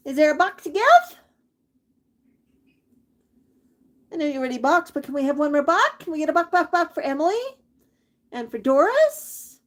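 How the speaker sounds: high sing-song coaxing of the bird; high pet talk. Real, not acted. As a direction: keep a bright lilting voice for the parrot. Long coaxing of the parrot.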